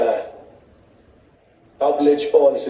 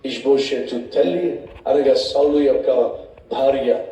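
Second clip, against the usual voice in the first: ordinary talk, nothing marked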